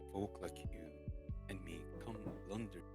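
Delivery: ordinary delivery, nothing marked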